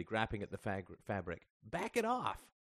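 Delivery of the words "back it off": high-pitched protest; sudden cry. A narrator's reading. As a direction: cry out as the scarf chokes you. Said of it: "Justin cried suddenly".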